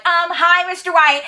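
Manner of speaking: high, mock-sweet interrupting hello; imitated pointed greeting. Rival waitresses butting in on her chat, mock-sweet. Acting waitresses cutting in for attention.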